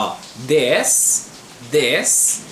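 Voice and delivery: "this" said with a short open vowel, close to "dés"; careful pronunciation. Shown as a demonstration. Teacher modelling "this" for students, careful pronunciation. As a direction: model "this" slowly and clearly for the class. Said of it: "muito importante frisar na pronúncia".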